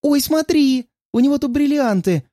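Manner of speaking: squealing with delight, very high; bubbly sorority girl. Acted. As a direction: gush over the diamonds like a giddy girl. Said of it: "завизжала от восторга".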